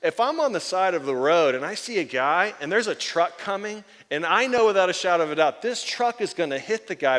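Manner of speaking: loud, urgent retelling of the truck image; emphatic quote. Retold or imitated. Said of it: "he said this".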